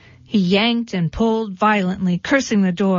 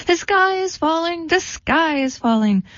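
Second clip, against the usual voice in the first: high, frantic alarm call; high barnyard panic, calling to everyone; panicked cry; 7 semitones higher than usual. Acted. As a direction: shriek it out like a terrified hen. Birds panicking, running down the road.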